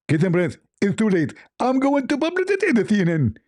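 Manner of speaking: high-pitched caricature voice, rising as he gloats; mock a triumphant debater in a squeaky voice; hammed-up gotcha in a put-on high voice; Zakir Naik. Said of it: "Zakir Naik, hold on".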